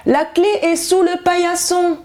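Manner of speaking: high, softened wolf calling out; wolf trying to sound gentle and grandmotherly; wolf softening his voice. A narrator's reading. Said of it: "en adoucissant un peu sa voix".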